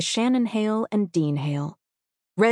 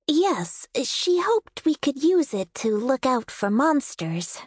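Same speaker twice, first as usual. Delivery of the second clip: cartoon princess; 7 semitones higher than usual. Princess in Blankets speaking.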